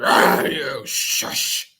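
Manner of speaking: low, drawn-out growled threat; growling dog. A narrator's reading. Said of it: "Mister Dog growled".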